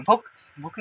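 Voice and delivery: भूख rushed, vowel cut short; wrong short u. Shown as a demonstration. Teacher repeating what not to say, vowel clipped. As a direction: snap through भूख with a brief u. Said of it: "नहीं बोलेंगे".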